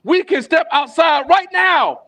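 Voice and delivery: a hammed-up poetic gang yell; yelling threat. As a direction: bark the threat slow and high. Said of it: "he started yelling".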